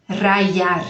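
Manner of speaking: Rayar with the y said like an i glide; y as i sound. Shown as a demonstration. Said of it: "Eu falo rayar com som de I".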